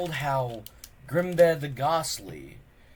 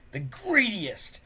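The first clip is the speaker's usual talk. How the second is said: ordinary delivery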